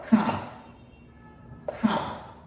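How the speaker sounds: "Calf" said without the L, breathy and soft; teacher dropping the L in "Calf"; silent L in calf. Shown as a demonstration. Teacher shows class "Calf" with no L sound. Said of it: "Pas prononcer L là".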